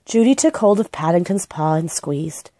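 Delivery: usual speaking voice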